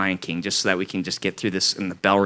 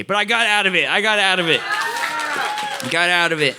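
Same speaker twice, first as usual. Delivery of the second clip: triumphant, slowing to a savor; gloating; 8 semitones higher than usual. Real, not acted. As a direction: savor the escape.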